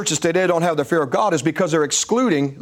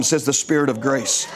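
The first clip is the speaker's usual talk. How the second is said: ordinary talk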